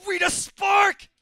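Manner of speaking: high, loud, drawn-out shout; shouted callout. Real, not acted. Gamer hyping his own move, drawn-out shout. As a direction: bellow the move name.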